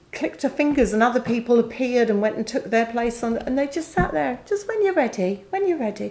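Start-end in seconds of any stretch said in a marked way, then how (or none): 4.4-6.1 reassuring. Retold or imitated. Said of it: "kind staff soothing her".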